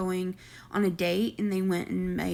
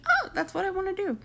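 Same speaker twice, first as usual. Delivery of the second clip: high, airheaded mocking voice; mock revelation; 11 semitones higher than usual. Man coming out of jail with a plan, mocking. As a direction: mimic a silly bright idea. Mocking would-be rappers' thinking.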